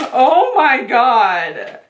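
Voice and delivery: long, drawn-out amazed exclamation. Real, not acted. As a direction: draw it out in awe. Stunned on seeing the palette.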